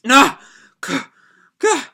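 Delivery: loud, high cry of pain; strangled cry of pain, broken off. Acted. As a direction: gasp out in pain as the bind tightens. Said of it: "collapses to her knees".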